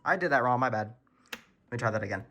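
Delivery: ordinary talk, nothing marked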